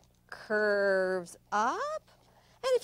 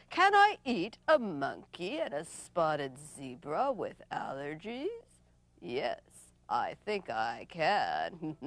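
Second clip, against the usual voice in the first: sly leopard; 4 semitones lower than usual. Acted. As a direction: savor the thought, deliberately. Leopard plots to eat them.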